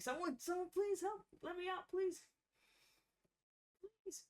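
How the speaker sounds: high, pleading voice; begging prisoner. Acted. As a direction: beg to be let out.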